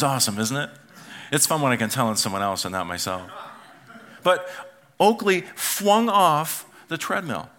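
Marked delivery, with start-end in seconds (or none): 5.5-6.6 "flung" stretched out as "fwung"; childish mispronunciation. Retold or imitated. Retelling grandson's accident, "flung" drawn out as "fwung". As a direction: say "flung" with a W, slow and high. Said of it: "flung means flung".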